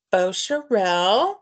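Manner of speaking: "Beaucherel" said slowly the French way; careful French-style "Beaucherel"; French pronunciation. Real, not acted. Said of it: "French pr-, pronunciation".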